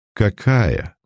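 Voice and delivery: mocking. A narrator's reading. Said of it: "прозвучал насмешливый голос".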